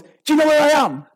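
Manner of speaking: high-pitched mock outrage; indignant challenge. Driver demanding recognition from the moped rider, acted indignation. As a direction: pull rank in a road-rage row. Acted road rage boast.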